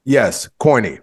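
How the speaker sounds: ordinary talk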